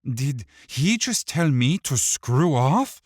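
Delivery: mock outrage, high and loud; feigned shock. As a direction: act scandalized. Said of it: "feigned shock and irritation".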